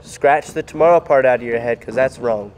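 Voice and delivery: ordinary talk, nothing marked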